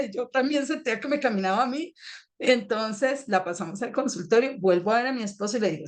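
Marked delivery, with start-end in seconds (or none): none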